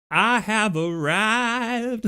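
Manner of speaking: slow, high, drawn-out entrance voice for a haunted doll; grand arrival. Retold or imitated. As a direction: announce yourself like a creepy doll making an entrance. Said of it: "Haunted Doll walking in like".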